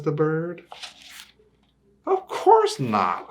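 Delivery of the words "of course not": much higher, louder indignant cry; as if the idea is absurd; cat indignant at being asked to fly; scoffing cat. Acted.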